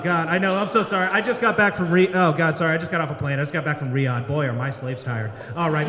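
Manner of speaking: ordinary talk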